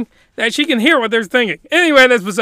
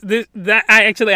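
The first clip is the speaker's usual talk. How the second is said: usual speaking voice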